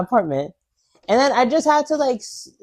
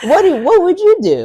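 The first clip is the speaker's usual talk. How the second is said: mock host question; 10 semitones higher, 5 dB louder than usual. Game-show style question.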